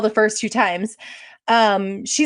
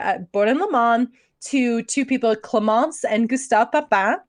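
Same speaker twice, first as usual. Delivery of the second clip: usual speaking voice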